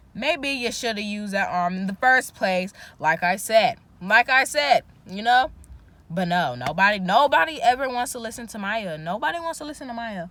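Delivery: loud exasperated complaint; told you so. Real, not acted. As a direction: vent loudly that nobody listens.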